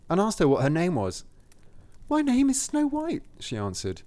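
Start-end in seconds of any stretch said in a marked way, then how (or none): 2.1-3.2 put-on very high storybook-girl voice. A narrator's reading.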